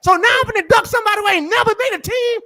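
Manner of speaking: fast, high scornful ranting; host mocking the idea he'd be ducking; incredulous rant. Real, not acted. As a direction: rattle it off in disgusted disbelief.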